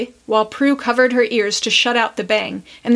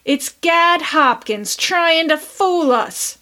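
disgusted cry; 6 semitones higher, slower than usual. A narrator's reading. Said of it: "much disgusted".

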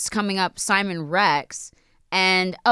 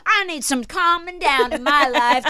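mock rock star; 9 semitones higher, slower than usual. Rock star wanting relaxation.

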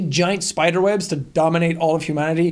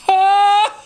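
high screechy gull cackle; screechy seagull voice; 12 semitones higher, 5 dB louder than usual. Retold or imitated. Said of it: "some of them are just like".

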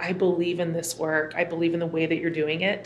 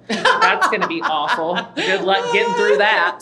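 louder, laughing through the joke; laughing tease; 3 semitones higher, 8 dB louder, slower than usual. Real, not acted. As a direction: tease her while laughing. Teasing about [name].

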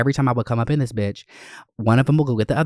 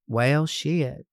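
higher, drawn-out catchphrase in tribute; Leslie Jordan; 4 semitones higher than usual. Acted. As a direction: stretch it out like his famous greeting. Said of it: "Leslie Jordan".

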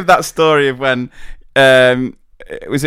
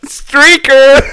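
put-on higher, playful kid's cry; mock kid shout; 9 semitones higher, 5 dB louder than usual. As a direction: yell it like a cheeky pupil. Kids shouting at streaker.

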